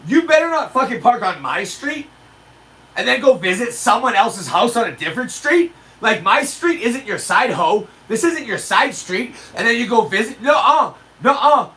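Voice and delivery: hammed-up outrage at a trespasser; mock indignant rant. Homeowner warning drivers off his street, mock indignant. Ranting at people parking there.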